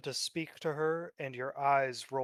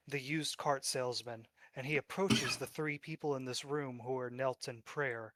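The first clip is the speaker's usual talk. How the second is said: ordinary talk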